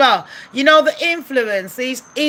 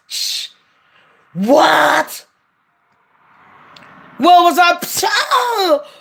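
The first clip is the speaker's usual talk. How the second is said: a dazed man asking what happened, higher, slow and laughing; dazed Will; 5 semitones higher, much slower than usual. Retold or imitated. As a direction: play him stunned and lost, half laughing. Will voiced, dazed after the slap.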